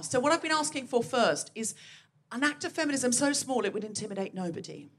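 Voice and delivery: ordinary delivery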